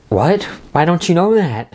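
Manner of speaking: imitating alarmed disbelief, pitched high; retold scared. Recapping Agnes questioning Vision about the Avengers, mock scared. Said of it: "scared that he doesn't know that".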